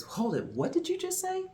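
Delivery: put-on a young woman suddenly catching on; startled young clerk. Young clerk interrupting the customer, high and startled. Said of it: "The young lady says".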